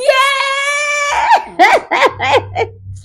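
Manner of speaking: high cackling laughter; delighted laugh. Real, not acted. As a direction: break up laughing after the scream. Laughs after imitating screaming crowd.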